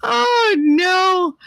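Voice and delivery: mock horrified yelp; shocked cry. Real, not acted. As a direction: cry out in disbelief. Shocked by chat comment.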